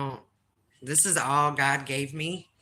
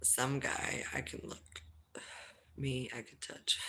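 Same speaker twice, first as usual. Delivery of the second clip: whisper; 8 dB quieter than usual. Pretend. Mock rules, whispered.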